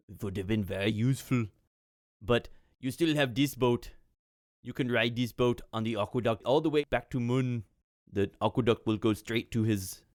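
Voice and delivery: put-on accented official, regretful then helpful; measured advice in a foreign accent; Atulf. Atulf offers the party the minister's boat, accented and helpful.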